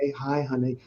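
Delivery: put-on softly soothing a crying toddler; a gentle, quiet coo to calm the child; soothing parent. Father calming his screaming two-year-old, soft and soothing. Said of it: "And part's like".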